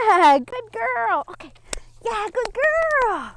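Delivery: high, stretched, gushing praise; excited dog praise. Real, not acted. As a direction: reward the dog with long high-pitched cheers. Praising the dog while training.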